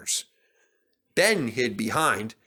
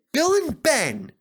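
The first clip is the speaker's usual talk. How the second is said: high, slow stern call; scolding; 14 semitones higher, 4 dB louder, slower than usual. A narrator's reading. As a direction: tell them off. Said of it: "he scolded the twins".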